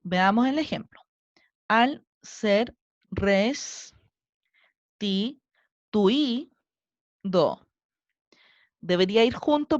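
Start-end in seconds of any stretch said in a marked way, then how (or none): 1.7-7.6 very slow, each syllable set apart. Shown as a demonstration. Teacher splitting a verse into syllables, slowly chunked. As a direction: break the line into separate, drawn-out syllables. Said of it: "cuando separemos nuestra sílaba".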